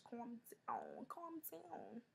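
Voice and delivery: fading into a soft whisper. Retold or imitated. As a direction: hush him, dropping to a whisper. Calming fiance in the Uber.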